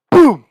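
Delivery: loud, high "boom"; imitated gunshot. Hunter mimics his rifle shot, explosive. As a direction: punch it out like a rifle going off.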